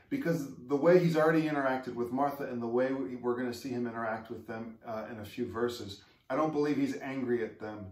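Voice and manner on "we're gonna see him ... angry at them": ordinary delivery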